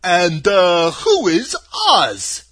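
loud, slow scarecrow voice; husky. A narrator's reading. Scarecrow inquiring who Oz is, loud and husky. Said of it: "in a rather husky voice".

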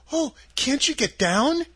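put-on concerned little girl. Dorothy asks.

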